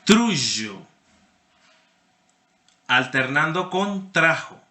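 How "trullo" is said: trullo with a buzzing zh sound, tɾuʒo; medieval trullo. Shown as a demonstration. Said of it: "Suena así como un abejorro".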